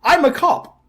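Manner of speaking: mock indignant bystander; indignant man. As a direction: play the wronged guy, half-laughing. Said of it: "And he's like".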